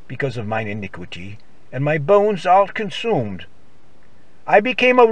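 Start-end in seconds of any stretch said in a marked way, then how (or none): none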